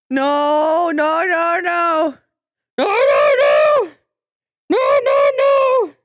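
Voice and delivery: loud pleading no's; desperate loud protest. Real, not acted. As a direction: yell no over and over as bombs drop. Streamer protesting gifted bombs.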